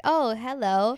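mock surprise. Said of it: "and it was like".

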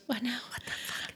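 baffled whisper; mock bewilderment. She reacts to words in another language, baffled mock whisper. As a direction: drop to a whisper, as if thrown. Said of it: "and she goes".

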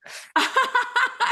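a sudden high, delighted laugh; bright squeal of a laugh; high burst of laughter. Real, not acted.

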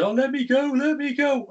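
high mock damsel plea; whiny helpless damsel voice; damsel in distress. Mocking Sonya as damsel.

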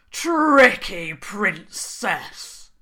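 slow, loud, grumbling troll voice; grudging troll king. Acted. Troll King grudgingly admitting defeat to the princess, slow and loud. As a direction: drag it out, sulky and beaten.

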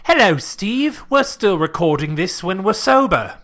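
put-on a mild Cockney-Australian British accent; mild put-on British accent greeting the cohost. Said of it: "we had British accents".

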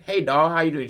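waitress greeting. Acted. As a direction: play a waitress hailing a customer. Waitress greets boy in story.

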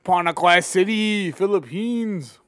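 slow, loud ring announcer call. Retold or imitated. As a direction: stretch it like a boxing announcer. Mimicking fight announcer.

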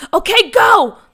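loud, high command to attack; yelling. Acted. McKinley signaling the crew to start firing, yelled. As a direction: shout go to launch the ambush. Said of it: "McKinley yelled".

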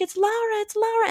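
put-on very high, repeated kid chirp; imitated excited kids. Kids who love each other greeting Lara, imitated excitement. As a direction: chirp it like thrilled children.

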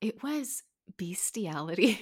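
breathy whisper, slowed down; whispered reveal. Real, not acted. Telling her friend the shocking find, in a whisper. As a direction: whisper it like a shameful secret.

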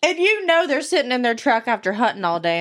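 normal talking voice

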